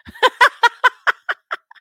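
loud, high burst of laughter; can't hold back the laugh. Real, not acted. Vlogger laughing at the defendant calling the judge milady, loud laugh.

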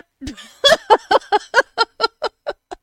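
high, loud burst of laughter; real cackle running on; helpless laughter. Real, not acted. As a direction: give in to a long laughing fit. Breaking up at own story.

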